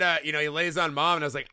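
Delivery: normal talking voice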